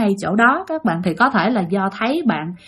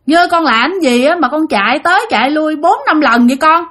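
lightly voiced mother, higher-pitched scolding call; 6 semitones higher than usual. Ghostly mother's voice calls the child from the café, calling out. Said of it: "Nghe kêu vậy nè".

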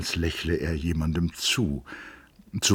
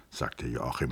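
ordinary delivery

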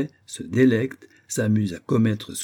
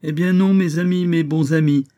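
raised, louder voice; emphatic protest; 7 semitones higher, 6 dB louder than usual. Acted. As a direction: protest loudly to your friends. Protests to friends he is sane.

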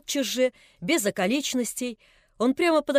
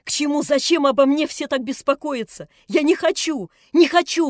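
put-on higher, louder frantic girl's scream; frenzied cry; 3 semitones higher, 7 dB louder than usual. A narrator's reading. Nelli in a frenzy refusing everyone, frantic cry. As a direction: cry out beside herself. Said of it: "вскрикнула вдруг Нелли в каком-то исступлении".

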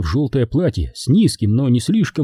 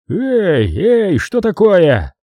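slow, higher booming call; 8 semitones higher, 3 dB louder, much slower than usual. Acted. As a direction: trumpet it out like a big constable. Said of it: "протрубил зычный голос".

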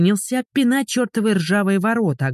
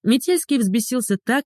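usual speaking voice

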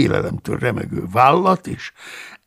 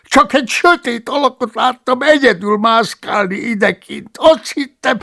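much higher, pleading monk voice; apologetic excuse; 11 semitones higher, 5 dB louder than usual. Acted. The cellarius excusing himself to Paulus, pleading. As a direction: make excuses, whining.

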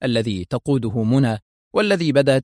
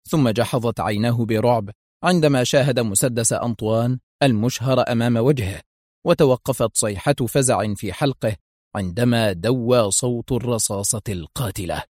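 ordinary delivery, nothing marked

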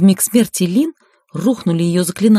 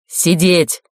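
barked command. A narrator's reading. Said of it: "рявкнула Лин".

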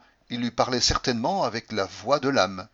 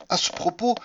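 lightly voiced higher Patrick; a hint of his mannered storytelling; Patrick's phrase; 9 semitones higher than usual. A narrator's reading. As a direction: mildly mimic the pet phrase. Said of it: "ce que je pouvais souffrir en l'entendant dire".

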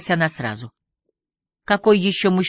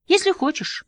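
«Если» much higher, breezy and unbothered; carefree. Acted. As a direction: toss it off lightly. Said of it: "беззаботно согласился Гэвин". (беззаботно, высоким голосом)

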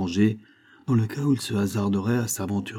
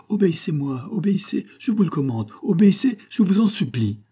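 much higher, insistent pleading; desperate cry; 10 semitones higher than usual. A narrator's reading. Helpless principal begging his pupils to obey, crying out. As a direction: order them, then beg, high-pitched and urgent. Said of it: "cria le pauvre principal".

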